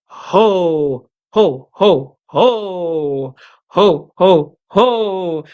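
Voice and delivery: loud chest-voiced belly ho ho; Santa ho ho ho. Shown as a demonstration. Teacher demonstrates chest-resonant Santa laugh. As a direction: drop into the chest and pump each ho. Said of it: "Resonate from the chest".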